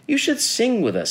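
high, sing-song bird voice; chirpy invitation. A narrator's reading. Birds inviting Jim to sing along, chirpy.

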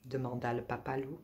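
ordinary delivery

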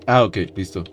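ordinary talk, nothing marked

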